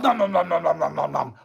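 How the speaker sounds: a hint of Cookie Monster munching. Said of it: "Cookie Monster".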